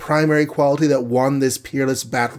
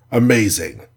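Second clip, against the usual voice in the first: ordinary delivery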